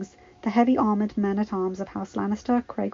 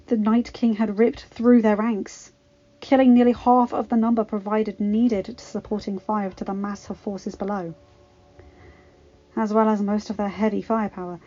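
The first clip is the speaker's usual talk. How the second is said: ordinary delivery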